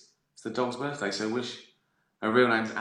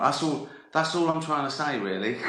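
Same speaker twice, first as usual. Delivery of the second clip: ordinary talk, nothing marked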